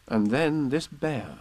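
plain reading voice

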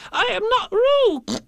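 high, loud squeaked denial; indignant little animal squeal; loud protest. Acted. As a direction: insist slowly in a tiny shrieking voice. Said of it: "said Piglet loudly".

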